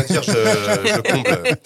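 high, giggling through the words; laughing. Real, not acted. Host filling airtime laughs at having nothing to say. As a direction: laugh while you pad for time.

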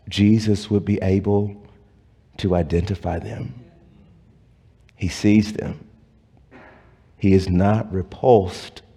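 quiet sermon, each phrase set apart by pauses; hushed preaching. Acted. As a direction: lean in and bring it down to a tender hush. Soft intimate preaching.

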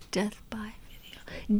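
dramatic whisper. Acted. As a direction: whisper the name. Whispered band name.

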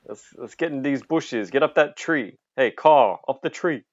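high-pitched Brooklyn gang boss; Brooklyn accent. Acted. Character ordering his gang into hiding, Brooklyn accent. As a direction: order them about in a put-on Brooklyn accent. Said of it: "He's from Brooklyn".

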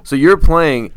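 ordinary talk, nothing marked

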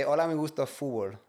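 Spanish accent. Shown as a demonstration.

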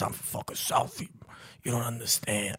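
put-on a clumsy tough-guy South Boston accent, half whispered; a Boston movie tough guy done badly in a Southie accent; a failed attempt at a Southie accent. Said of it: "I can't do that fucking accent".

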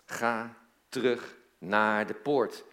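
slow, word-by-word order with pauses; deliberate, weighty quoted command; emphatic slow command. Retold or imitated. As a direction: space out each word like an order.

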